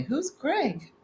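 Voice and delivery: high-pitched mock query; puzzled quote. Voices a question from chat.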